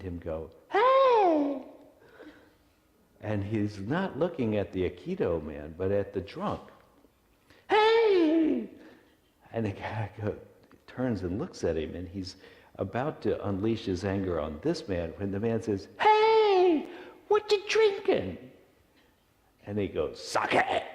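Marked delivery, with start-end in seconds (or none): 0.7-1.6 high, loud "hey" from behind; long high-pitched shout to get someone's attention; calling out. Acted. Man calls out to drunk. 7.7-8.7 high, loud drawn-out holler; very high-pitched call. Acted. Man calls out to drunk. 16.0-18.4 put-on high, loud old man's holler; loud friendly shout in a high voice; calling out. Old man calls to drunk. 20.2-20.8 put-on loud, rough drunken bark; harsh one-word drunken shout; belligerent drunk.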